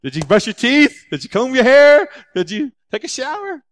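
high, loud fussing parent; parent checking on kids. Acted. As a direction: quiz them like a parent at the door. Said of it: "He talks to us like junior hires".

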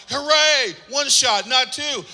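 mock cheer. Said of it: "I'm like".

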